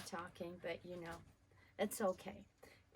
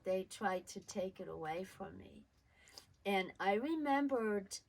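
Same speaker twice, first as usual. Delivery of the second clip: ordinary delivery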